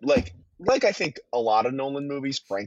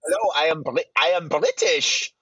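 mock German accent; 3 semitones higher than usual. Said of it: "introduced himself with a German accent".

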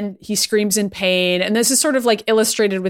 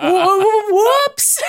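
mock whoops; 10 semitones higher, 4 dB louder than usual. Acting Logan dropping the canister.

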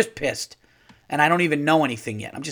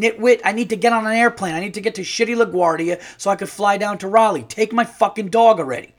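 raised, higher, frustrated venting; exasperated rant; 6 semitones higher, 4 dB louder than usual. Retold or imitated. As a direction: blurt it out, fed up. Fed-up rant about dog boarding.

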